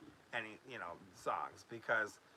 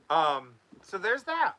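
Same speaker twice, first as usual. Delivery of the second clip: laughing; 5 semitones higher, 7 dB louder than usual. Real, not acted. Embarrassed laugh.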